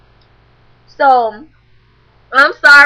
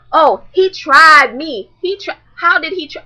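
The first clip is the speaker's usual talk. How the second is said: mocking dramatic girl; 5 semitones higher, 5 dB louder than usual. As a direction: overplay her drama. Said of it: "So now she's like".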